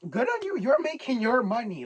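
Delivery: high, loud hype; excited cheer. Real, not acted. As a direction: cheer them on gleefully. Cheering on the confessor.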